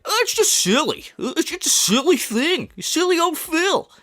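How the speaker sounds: high, sing-song mock of a streamer brushing it off; hammed-up high mimic of the streamer; mocking Phil. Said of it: "And then when he does it".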